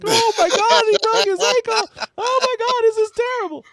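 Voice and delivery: hammed-up falsetto shriek of horror; mock-hysterical high screaming; voice octaves up. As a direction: squeal it very high and loud, imitating your own panic. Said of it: "my voice goes up, like, three octaves".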